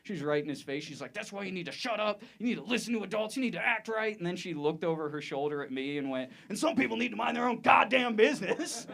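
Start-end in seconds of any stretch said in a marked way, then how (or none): 1.1-8.9 mock-scold a child, pitched up; imitated yelling mother. Lady scolding her kid on the train, imitated yelling. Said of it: "I saw a lady yelling at her kid".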